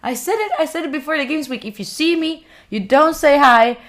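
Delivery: ordinary delivery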